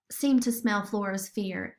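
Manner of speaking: usual speaking voice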